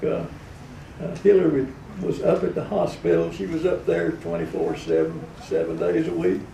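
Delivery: chummy politician phoning in, pitch jumping about; Bill Clinton. Acted. President calling a radio show to praise it, Clinton impression. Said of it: "Bill Clinton was in his heyday".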